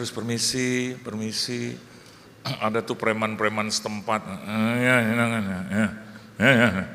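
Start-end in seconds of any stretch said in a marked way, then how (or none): 4.3-6.8 mumbling thugs. Retold or imitated. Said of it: "Bahasanya nggak jelas gitu".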